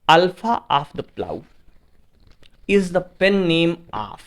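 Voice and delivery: slow, spaced-out reading of the question; teacher reading the exam question slowly to the class; slow dictation. Acted. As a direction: read each word out as if for dictation. Teacher reads quiz question slowly.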